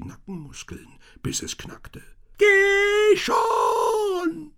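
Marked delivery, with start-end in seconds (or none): 2.4-4.5 much higher, louder otherworldly shout; shrill demon cry; demon calling. A narrator's reading. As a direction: bark it out as a demon. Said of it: "rief der Dämon".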